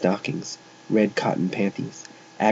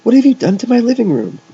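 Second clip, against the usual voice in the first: very high, loud angry question; aghast outrage; 13 semitones higher, 7 dB louder, faster than usual. A narrator's reading. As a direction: demand it, appalled at the mess. Mother finds her room wrecked.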